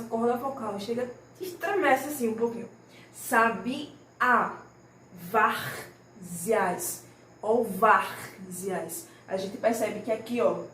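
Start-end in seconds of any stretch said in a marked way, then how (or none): 3.1-4.6 "Sabiá" broken into drawn-out syllables; stressed-syllable demo. Shown as a demonstration. Teacher shows pupils the tonic syllable, syllable-by-syllable stress. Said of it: "a gente pronuncia com mais força". 5.3-9.1 "várzeas" slowly, VÁR held and forceful; stressed-syllable demo. Shown as a demonstration. Teacher shows how the throat vibrates, stress on VÁR. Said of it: "extremamente forte a sílaba tônica".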